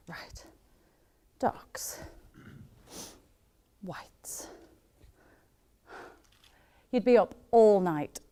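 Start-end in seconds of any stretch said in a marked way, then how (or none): 0.1-4.5 hushed, half-whispered single words; muttering to herself. Acted. Sorting the washing alone, muttering to herself. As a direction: murmur it while busy with a chore.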